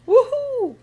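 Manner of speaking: high, loud whoop; excited cheer. A narrator's reading. Pirate crew setting off for treasure, cheering. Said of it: "cries the crew".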